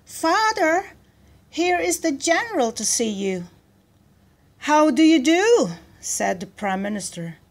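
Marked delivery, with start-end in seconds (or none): 0.0-3.4 put-on high-pitched girl's call. A narrator's reading. Daughter calling her father to the door, high call. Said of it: "She turned away and called". 4.7-5.7 loud, high-pitched welcome; hearty greeting. A narrator's reading. Prime minister greeting the general, hearty.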